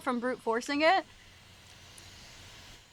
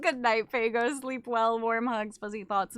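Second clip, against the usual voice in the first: ordinary talk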